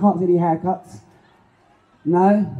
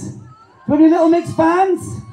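calling out; 9 semitones higher than usual. Real, not acted. Calling out to crowd.

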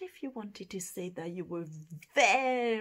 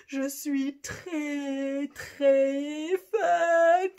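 très, très, louder and rising on fatigué; très doubled; 5 semitones higher, 11 dB louder, much slower than usual. Shown as a demonstration. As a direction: lean hard on très twice. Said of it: "very, very tired".

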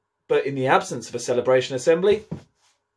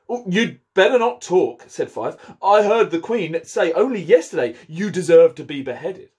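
lively read-aloud storytelling voice; story narration; 5 semitones higher, 4 dB louder than usual. A narrator's reading. Head teacher reading Alice to pupils, animated storytelling. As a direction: read the chapter like a bedtime story for the class.

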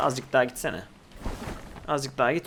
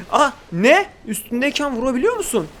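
shocked surprise; 9 semitones higher, 6 dB louder than usual. Real, not acted. Shocked the eel hits the boat.

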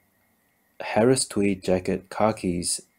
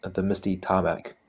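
ordinary delivery, nothing marked

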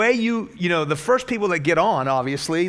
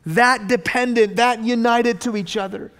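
louder, higher, building fervour; preaching at full force; 6 semitones higher, 4 dB louder than usual. Acted. Pastor preaches unity in the gospel, building fervent preaching. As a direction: ramp it up to a passionate climax.

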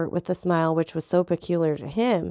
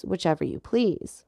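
ordinary delivery, nothing marked

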